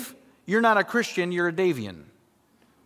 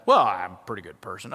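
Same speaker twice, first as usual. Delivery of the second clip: much lower, self-satisfied voice; lightly hammed-up smug reply; mock complacent person; 11 semitones lower, faster than usual. Preacher mimicking the average person on the street, smug.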